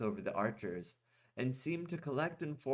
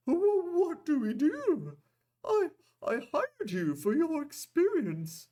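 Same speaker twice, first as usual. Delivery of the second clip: very high, loud, stammering panic; trembling fear; 7 dB louder than usual. A narrator's reading. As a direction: squeal it out in terror.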